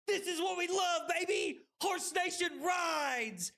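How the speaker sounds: high, hyped rallying cry; elated streamer shout; triumphant cheering. Real, not acted. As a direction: cheer your team on at a high pitch. Celebrating the win.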